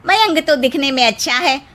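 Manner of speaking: mocking neighbour. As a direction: jeer at the short bride like a nosy neighbour. Neighbour mocking the short bride.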